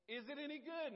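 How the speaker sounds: loud, high question; called question. Retold or imitated. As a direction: call it out again. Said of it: "I'll say".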